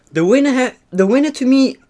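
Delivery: high, loud winner reveal; excited announcement. Real, not acted. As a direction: build it up like a big reveal.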